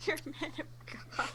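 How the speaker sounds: high, very soft squeak; small-voiced appeal. Player repeating the appeal to the spirit, small-voiced. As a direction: shrink the plea to a tiny high voice.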